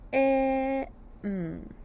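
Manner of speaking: short "I" held, then a long hummed "mm"; sounded out. Shown as a demonstration. Teacher sounds im, one sound at a time. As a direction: separate "I" and "mm", holding each sound. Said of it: "Sound im".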